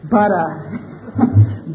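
ordinary delivery

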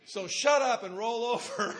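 slow, loud, laughing command; loud joking order. Retold or imitated. Son telling his mother to roll over, loud laughing order. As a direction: boss her around as a loving joke.

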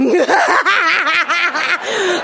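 high, hammed-up gloating laugh; gleeful cackle. Wife re-enacts hanging up phone, gleeful cackle. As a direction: cackle like revenge has just landed in your lap. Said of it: "hung up that phone and went".

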